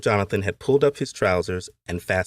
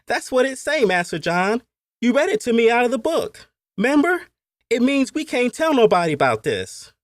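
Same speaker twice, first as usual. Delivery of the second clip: put-on very high child's voice, loud; young boy explaining; 5 dB louder than usual. A narrator's reading. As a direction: play an insistent little boy, pitched way up. Boy explains his quotation.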